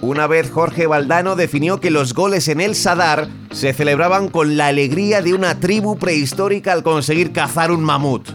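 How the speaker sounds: normal talking voice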